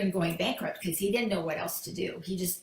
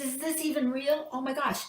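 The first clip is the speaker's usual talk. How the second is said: higher-pitched acted amazement; amazed disbelief; 6 semitones higher than usual. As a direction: act stunned by sudden good luck. Businessman discovers huge check.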